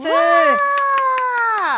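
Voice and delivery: long, high, loud wow; drawn-out squeal of delight; excited cheer. Real, not acted. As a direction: whoop at the big news, stretched right out. Cheers the guest announcement.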